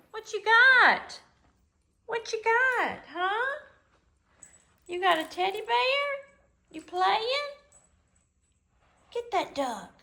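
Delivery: very high, loud sing-song pet voice. Real, not acted. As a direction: owner cooing at the dog with its teddy. Baby talk to the dog.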